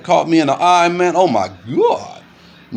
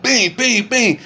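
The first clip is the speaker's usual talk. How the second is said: punch sound effects; 7 semitones higher, much slower than usual. Retold or imitated. Imitating punches in a fight.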